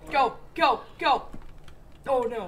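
high, loud terrified shouting; scared horror game streamer. Real, not acted. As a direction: yell in panic at the monster. Fleeing the monster.

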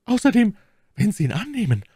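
very high, insistent lady's voice; voice full of emotion. A narrator's reading. Milady pressing her ring on the count, deeply moved. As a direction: plead with feeling, high-pitched. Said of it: "fügte sie mit bewegter Stimme bei".

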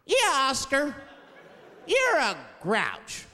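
mock kid chanting, drawn out; taunting like a kids' chorus; high slow kid taunt. TV kids chant.

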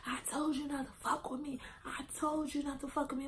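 high, strained, hushed fury; re-enacted seething. Fighter warns friend in headlock, high hushed seething. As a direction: act out seething through a tight high voice.

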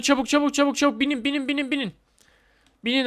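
high, rapid urging; frantic high call to get in; urgent hurry. Real, not acted. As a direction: hurry everyone on board. Urging to board moving ride.

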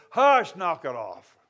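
firm rebuke. Retold or imitated. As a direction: shut it down like scolding kids. Jesus rebukes the storm.

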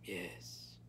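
low, soft answer; hushed, low certainty; calm confirmation. A narrator's reading. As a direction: confirm quietly, unmoved. Grandmother answers calmly.

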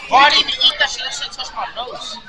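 ordinary delivery

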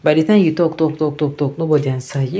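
ordinary talk, nothing marked

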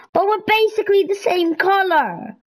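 normal talking voice

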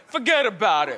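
imitating a New York tri-state accent; New York accent, "forget about it" tossed off; brash New York accent, lightly done. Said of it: "He talks like this".

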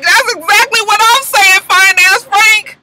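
high, loud, slow delighted exclaim; excited reaction. Real, not acted. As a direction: squeal it at the screen. Viewer reacting to Frank.

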